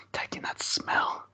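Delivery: breathy hushed whisper. Real, not acted. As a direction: whisper while sniffing. Sniffing the poured beer.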